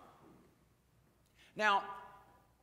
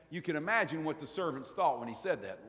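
ordinary delivery

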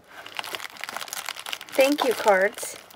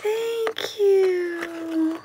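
high, crying thanks; real, touched thanks; teary thanks; 7 semitones higher than usual. Real, not acted. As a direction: thank her through happy tears. Tearful thanks.

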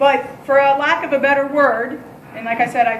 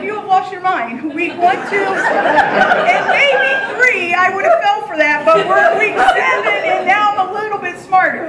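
higher, louder, laughing through the words; laughing disbelief; 6 semitones higher, 5 dB louder than usual. Retold or imitated. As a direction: laugh him off as if he's joking. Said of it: "I busted out laughing".

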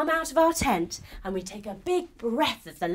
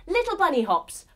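ordinary delivery, nothing marked

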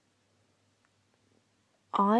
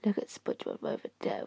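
whisper; 8 dB quieter than usual. Real, not acted. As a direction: sly whisper. Secretive aside in game.

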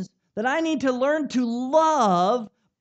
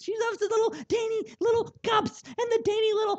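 dainty mock; 10 semitones higher than usual. Mimics daughter's dainty tea taste.

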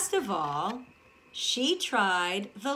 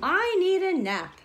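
yawning; 9 semitones higher, 5 dB louder than usual. Acted. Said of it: "yawned Goldilocks".

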